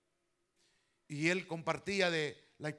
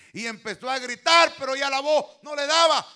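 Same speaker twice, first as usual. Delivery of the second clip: much louder and higher, ringing out; preaching at full force; 6 semitones higher, 13 dB louder than usual. Acted. Pastor telling the climber's story, shouting it out.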